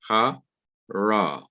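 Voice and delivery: "Ha, ra" split into two separate syllables. Shown as a demonstration. Teacher showing the two syllables of the word, syllable by syllable. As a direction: break it apart: "Ha", pause, "ra". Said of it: "one syllable ha and another syllable ra".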